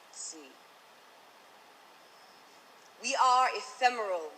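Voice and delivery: rhythmic slam poem delivery; spoken word poetry. Acted. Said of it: "I have written an original slam poem".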